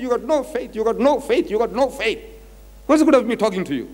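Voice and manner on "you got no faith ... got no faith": mock exasperated rebuke, pitched higher; provoked rebuke. Preacher voicing Jesus scolding his disciples, mildly exasperated. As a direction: lightly act out a provoked teacher scolding. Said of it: "he's provoked".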